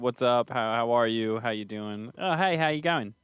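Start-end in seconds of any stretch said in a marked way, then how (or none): 2.2-3.1 higher-pitched imitated Australian greeting; Australian accent. Contrasting American greetings with Australia's, Australian accent. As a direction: lift it into a casual Australian hello. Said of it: "That's like Australia".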